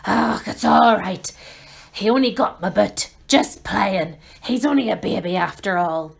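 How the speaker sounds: Hagrid voice. Acted. Hagrid bitten by dragon.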